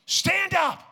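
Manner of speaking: high loud command shout; Jesus commanding. Retold or imitated. Preacher voicing Jesus telling the man to rise, a high shout. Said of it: "it's my interpretation".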